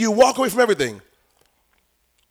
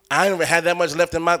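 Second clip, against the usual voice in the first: ordinary talk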